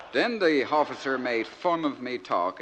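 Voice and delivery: naive outport soldier son reading his letter; broad dialect, lively comic recitation; George from Crinkle Cove. Acted. Soldier son writing home to Mama and Dadda, naive and earnest.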